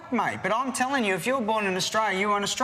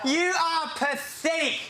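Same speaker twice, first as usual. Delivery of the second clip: high, mocking shout with a laugh in it; jeering shout; 7 semitones higher, slower than usual. Real, not acted. Taunting the housemate he is fighting, jeering high shout.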